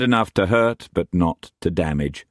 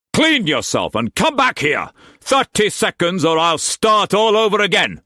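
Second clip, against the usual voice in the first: higher, louder raging master's voice; furious master; 14 semitones higher, 4 dB louder than usual. A narrator's reading. Struan raging at Mei Mei before whipping her, furious shout. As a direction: bark orders like an enraged lord.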